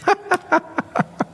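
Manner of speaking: a sudden high burst of laughter; quick high chuckle. Real, not acted. Preacher laughs at own joke.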